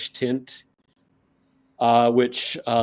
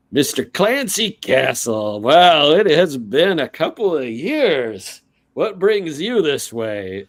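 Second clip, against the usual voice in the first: slow, loud, higher-pitched hearty welcome; hearty orc shopkeeper, delighted; 4 semitones higher, 6 dB louder, slower than usual. Acted. Orc shop owner greets returning Clancy, heartily delighted. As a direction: greet a long-missed friend with drawn-out delight.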